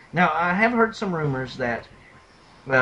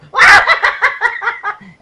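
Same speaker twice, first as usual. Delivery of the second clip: loud laugh; 10 dB louder than usual. Retold or imitated. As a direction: burst of high laughter. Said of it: "uncontrollable urge to laugh".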